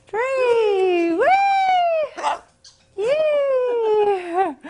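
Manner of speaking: rising playful whee for the bird; excited whee. Real, not acted. Owner swinging her bird, gleeful high whee. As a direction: lift the voice high on the swoop.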